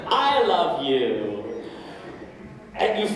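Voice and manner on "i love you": very slow, lower "I love you"; drawn-out unsure confession; hesitant, questioning. Retold or imitated. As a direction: let it come out as a question. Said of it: "You say".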